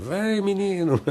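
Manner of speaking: high-pitched mum's call with a laugh; laughing, hammed-up scolding call; mother's mock yell. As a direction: playfully imitate a mother calling her son. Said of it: "a mãe gritava com o filho".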